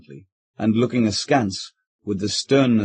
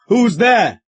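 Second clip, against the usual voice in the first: higher, louder frightened call; wild call; 10 semitones higher, 6 dB louder than usual. Acted. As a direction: call out like a man expecting a robber. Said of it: "the squire wildly called".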